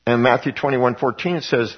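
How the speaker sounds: normal talking voice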